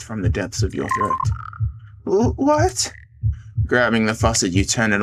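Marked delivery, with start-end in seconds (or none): 2.0-2.9 high, startled gasp of a question; shocked. Acted. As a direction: stammer it out in shock. Shocked character line.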